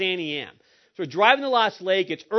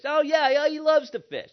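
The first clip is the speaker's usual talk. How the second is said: higher-pitched, lightly dismissive dad; retold breezy insistence; 7 semitones higher than usual. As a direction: brush off the doubt, pitched up. Father brushing off mother's doubt.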